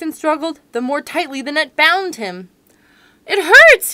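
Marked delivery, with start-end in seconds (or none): 3.3-3.9 high, very loud cry of pain; lion caught in a net, crying out. Acted. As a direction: yell it as a trapped lion. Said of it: "he cried".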